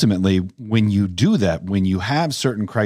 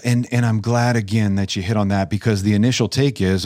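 normal talking voice

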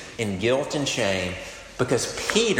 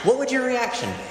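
high mock reaction; 10 semitones higher than usual. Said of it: "repeats question for effect".

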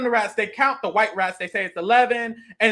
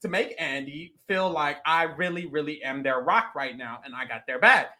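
inner resolve; 6 semitones lower, 5 dB quieter than usual. Retold or imitated. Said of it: "She's like".

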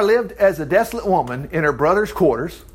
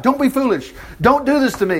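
higher-pitched narrated plea; crying out in protest; 6 semitones higher than usual. A narrator's reading. Sister begs her brother to stop, crying out mildly. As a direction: plead with him, lightly acted. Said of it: "she cried".

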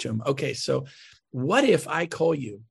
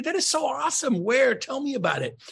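much higher, bright congratulation; genuinely excited; 9 semitones higher than usual. Retold or imitated. Agent congratulating lost clients, excited. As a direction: cheer them on sincerely. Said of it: "I always respond the same way".

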